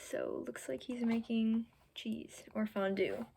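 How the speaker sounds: soft whispered commentary; whispered aside. Real, not acted. Reading to a child, whispering a picture comment. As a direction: lean in and whisper it.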